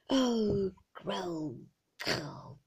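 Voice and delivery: groaned "oh" sinking into a throaty rattle; falling guttural growl; throat rattle. A narrator's reading. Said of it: "kind of a rattle in his throat".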